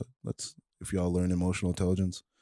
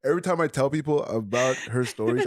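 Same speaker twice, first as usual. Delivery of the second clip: high, loud excited praise; 9 semitones higher, 5 dB louder than usual. Real, not acted. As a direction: gush about her, laughing.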